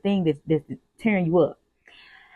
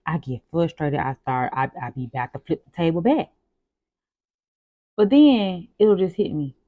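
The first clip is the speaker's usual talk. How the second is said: ordinary talk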